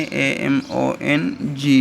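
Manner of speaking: A M O N G spelled letter by letter, slowly; slow spelling out. Shown as a demonstration. Teacher slowly spells the word for the class. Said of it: "among की spelling ये है".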